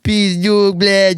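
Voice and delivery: high, hammed-up Russian accent; caricatured Russian speech; mock Russian accent. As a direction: toss out Russian curses in a joke accent. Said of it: "the Russians, we talk like this".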